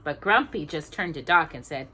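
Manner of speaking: plain reading voice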